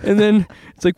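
ordinary delivery

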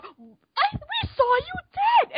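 high, loud, slow shocked yell; shocked cry. Retold or imitated. Voicing shocked movie characters, high shocked shout. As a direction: scream it like you've seen a ghost. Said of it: "and then people are".

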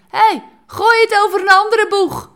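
loud, high call across the water; a stranger yelling from the bank; calling out from the shore. Retold or imitated. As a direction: shout it to a boat out on the water.

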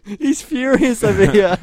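loud and laughing. Real, not acted. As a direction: crack up while teasing. Laughing at the drummer.

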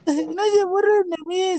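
slow, high mock whine of a bullied boy; lightly voiced teen begging them to stop; pleading classmate. Classmate voiced in retold story.

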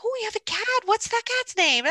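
very high, gushing mock excitement; delighted client. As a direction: imitate someone cooing over a cat. Imitates clients delighted by the cat.

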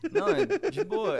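laughing through the words; high burst of laughter. Real, not acted. Teased about weed budget.

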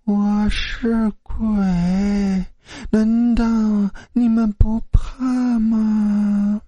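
high, slow, trembling wail; a ghost wailing to scare the roommates; quavering ghost wail. A narrator's reading. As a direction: drag each word out like a weeping spirit. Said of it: "你说话别老颤颤巍巍的".

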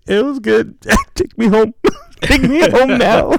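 high, laugh-broken plea to go home; high giggling mock embarrassment; sheepish laughing. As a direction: laugh nervously, hurry her out. Can't look wife in the eye.

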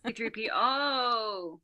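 slow "C three P O" with a long, falling O; stress on syllable. Shown as a demonstration. Echoing the syllable you emphasize, drawn-out stress on "O". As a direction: stretch the final O of "C three P O". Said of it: "the syllable that you emphasize".

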